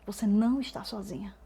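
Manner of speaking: soft, tender reassurance; silent reassurance. Retold or imitated. As the Holy Spirit comforting her in silence, soft reassurance. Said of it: "em silêncio, dissesse".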